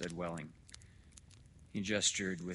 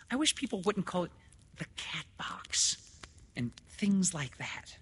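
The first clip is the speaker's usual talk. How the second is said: high, louder repeat, slowing and fading breathily; insistent objection; 12 semitones higher, 4 dB louder than usual. Acted. Woman repeating her objection to doctor, insistent and high. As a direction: start quick and high, then drag out the phrase. Lenora repeats herself after "What?.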